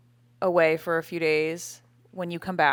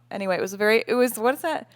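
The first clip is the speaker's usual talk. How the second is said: ordinary talk, nothing marked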